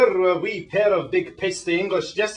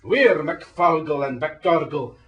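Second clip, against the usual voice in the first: put-on broad Scottish accent; 4 dB louder, slower than usual. Scots voice.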